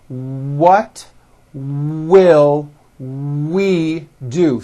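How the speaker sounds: very slow, with lips pushed forward on each W; slow careful W. Shown as a demonstration. Teacher modelling forward-mouth W words. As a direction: stretch out will and round the lips. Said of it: "Bring your mouth forward on those W words".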